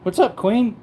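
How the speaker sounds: usual speaking voice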